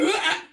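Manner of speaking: high yelped laugh, like a fright; startled laugh. Shown as a demonstration. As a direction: imitate your own scared laugh. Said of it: "That's what my laugh sounds like".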